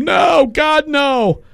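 high-pitched, drawn-out "no" in mock horror; hammed-up wail of refusal; horrified refusal. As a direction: cry it out like a dramatic protest. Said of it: "Jess is like".

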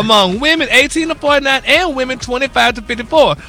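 high-energy, emphatic gossip news delivery; entertainment news read. Real, not acted. Radio host delivering celebrity news to the morning crew, high-energy news read.

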